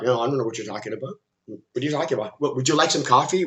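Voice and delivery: soft, low, fast unbothered mumble; imitating someone calm and unimpressed; unconcerned, dismissive. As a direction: brush it off casually, as if nothing is wrong. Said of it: "calm and relaxed and unconcerned".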